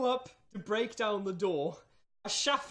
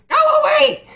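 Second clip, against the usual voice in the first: very high, loud shrill creature shriek; squeaky angry voice; 14 dB louder, faster than usual. Acted. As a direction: shriek like a tiny furious hermit. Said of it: "You hear a squeaky, angry voice".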